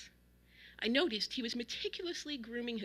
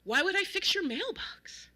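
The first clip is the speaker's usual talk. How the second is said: high, loud disbelieving question; raised, incredulous pushback; 9 semitones higher, 5 dB louder than usual. A narrator's reading. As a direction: ask it as if it's absurd. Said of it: "James looked incredulous".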